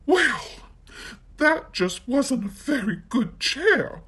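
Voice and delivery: put-on high, cross little girl; crossly. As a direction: huff at the chair in a high voice. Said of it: "she said crossly".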